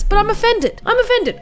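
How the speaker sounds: high, mock-offended whine. Real, not acted. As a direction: play up being hurt. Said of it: "I'm trying not to take offense".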